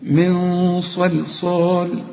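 من صلصال very slow with a heavy nasal nun; the right way, chanted; correct recitation. Shown as a demonstration. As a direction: recite من صلصال slowly, sads full and emphatic. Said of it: "لا".

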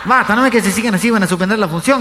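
high, loud exasperated warning; woman shouting across the audience; acted shout. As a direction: yell at them to stop. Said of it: "gritó otra mujer".

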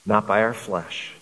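normal talking voice

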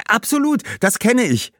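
high, eager cry of agreement; girl eagerly agreeing with Anand, calling out; eager exclamation. Acted. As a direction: burst out, very high, in recognition. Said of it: "rief Fatima".

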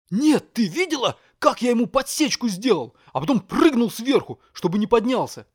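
put-on high, loud bragging boy; excited boy. A narrator's reading. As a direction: brag breathlessly like a boy.

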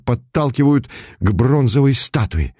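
plain reading voice

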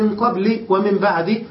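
قبل and بعد as qabli, ba'di; short i closing both قبل and بعد; kasra without tanween. Shown as a demonstration. Teacher contrasting readings, قبل and بعد ending in i. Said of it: "فقد حذف المضاف إليه ونوى لفظا معينا".

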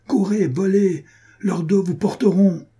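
high, insistent command; angry urging. A narrator's reading. Furious lady driving soldiers to the chase, angry urging. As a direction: urge them on, pitch held high. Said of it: "Blanche de colère".

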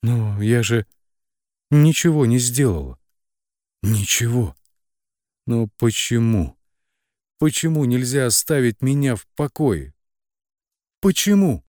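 pleading protest building to a high cry; desperate, mounting protest; frightened babbling. A narrator's reading. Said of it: "залепетала".

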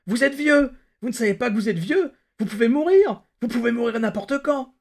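high, loud anguished outburst; desperate, high-pitched shouting in anguish; anguished howl. A narrator's reading. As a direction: cry out in panic, voice shooting up. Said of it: "soudain sa voix s'éleva en un hurlement d'angoisse".

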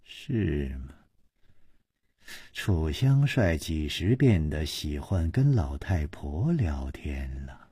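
low, breathy sneering question; mock him with a cold smile, dropping to a whisper. A narrator's reading. The young mistress sneers at Chu, cold and low. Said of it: "施少奶奶冷笑着说".

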